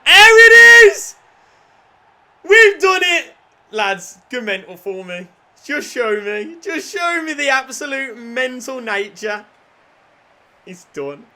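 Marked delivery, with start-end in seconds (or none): 0.1-3.3 huge high slow victory scream; triumphant roar. Real, not acted. Title winning moment. 3.7-9.4 raised, hyped call to the lads; excited urging. Real, not acted. Urging players to celebrate.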